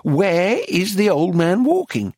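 put-on "where is the old man walking" high and over-enunciated; mouthed syllables. A narrator's reading. Dealer questions painter, "where is the old man walking" in mouthed syllables. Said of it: "mouthing her syllables".

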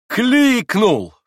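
high, loud overwrought cry; piercing one-word outburst; cried out. A narrator's reading. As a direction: shout it out, stretched and falling. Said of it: "прокричала она".

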